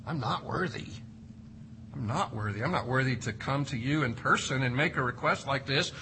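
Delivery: soft, low, humble; humble centurion. Acted. Centurion telling himself he is unworthy, soft and humble. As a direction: quietly own your unworthiness.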